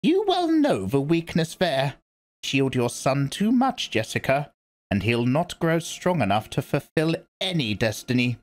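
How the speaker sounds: put-on grave old priestess speaking; stern old Reverend Mother. A narrator's reading. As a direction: lecture her like a severe elder. Old woman rebukes Jessica.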